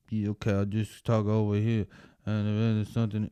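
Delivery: half-hearted mumbled rapper impression; unconfident mock rapper. Wannabe rapper asked for confidence, mumbling in mockery. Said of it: "Could you exude some sort of confidence and ability?".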